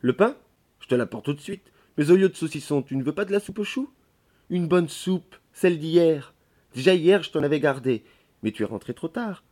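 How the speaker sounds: a hint of an eager servant; lightly voiced chatty maid. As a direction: coax him like a talkative maid. Voices chatty maid Nastasia offering soup.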